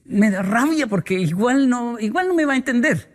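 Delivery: a spouse giving up on arguing; frustrated partner. Retold or imitated. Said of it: "llega a un punto donde dice". (voz de pareja frustrada, algo más aguda y lenta)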